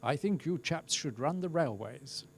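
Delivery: a light hint of a posh English accent; lightly voiced posh English royal spokesman. Royal spokesman praising the team, in a mild posh English accent. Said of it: "in his very posh English accent".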